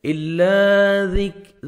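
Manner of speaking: الا with the لام held one second; stretched lam. Shown as a demonstration. Teacher stretches the lam, slow. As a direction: draw out the لا in الا. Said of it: "لام کو کھینچنا ہے".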